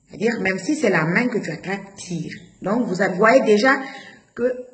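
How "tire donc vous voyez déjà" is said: normal talking voice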